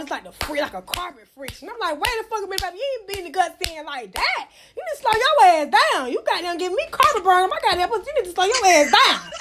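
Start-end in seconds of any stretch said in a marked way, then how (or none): 2.0-9.3 high-pitched mock complaint; exasperated, pitched-up reenactment; acted indignant protest. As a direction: act out telling a lover off. Acting out stopping a rough lover.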